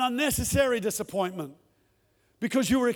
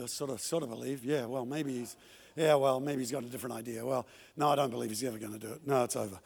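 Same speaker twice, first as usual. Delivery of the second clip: much lower, softer, fast doubtful mumble; doubting mumble; 11 semitones lower, 8 dB quieter, faster than usual. Retold or imitated. Preacher retelling his inner doubts, low defeated mumble. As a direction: let the faith drain into a defeated mutter. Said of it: "And I'm walking".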